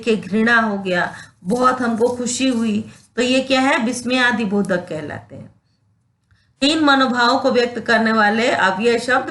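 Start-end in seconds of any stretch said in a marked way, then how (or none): none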